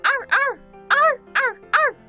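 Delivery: high yelping arr arr barks; high dog barks. Acted. Husky in distress calling out, high yelps. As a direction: yelp like a dog in the water.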